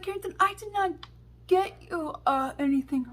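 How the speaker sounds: high-pitched mock indignation; indignant mom. Mom denies buying daughter the perfume, imitated indignation. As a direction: imitate a mother's high, insistent denial. Mom's indignant denial acted out.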